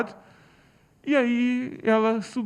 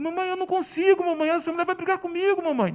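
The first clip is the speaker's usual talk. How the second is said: put-on high, pleading little boy; frightened son begging mom not to send him down; desperate child; 9 semitones higher than usual. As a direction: beg your mother like a scared seven-year-old.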